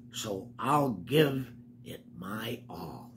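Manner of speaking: slow breathy whisper. Acted. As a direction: hush it like a secret to the kids. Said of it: "Listen to him".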